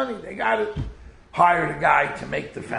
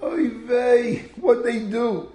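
alarmed cry; 7 semitones higher, much slower than usual. Retold or imitated. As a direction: cry out in dismay. Someone cries out in alarm.

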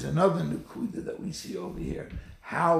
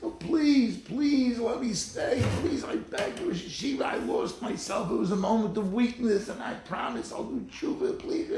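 higher, pleading student begging not to be expelled; desperate pleading; 7 semitones higher than usual. Acted. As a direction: beg the Rosh Yeshiva to let you stay. Student begs to stay in yeshiva.

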